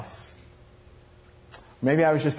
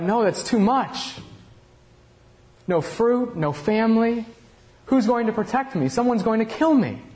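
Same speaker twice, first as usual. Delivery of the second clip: desperate outcry; 9 semitones higher than usual. Acted. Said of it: "I cried out".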